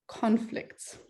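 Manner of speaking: usual speaking voice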